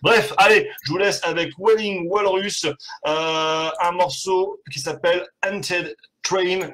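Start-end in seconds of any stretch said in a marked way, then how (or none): 3.8-6.7 host introduces the track in a playful mock Texan Québécois accent. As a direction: roll out the title in a fake Texan Québécois drawl. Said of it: "l'accent de ouf".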